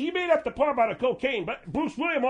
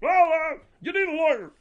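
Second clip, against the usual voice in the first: Bruce Williams impression; 5 semitones higher, 4 dB louder than usual. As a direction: play the talk-show host handing out advice. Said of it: "do the Bruce Williams".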